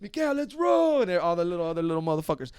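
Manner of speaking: high calling voices from the street; hammed-up calling from the curb; kids calling out. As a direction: call him out to the van. Kids calling him from outside.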